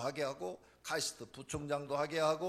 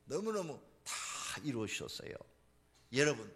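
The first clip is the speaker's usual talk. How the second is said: normal talking voice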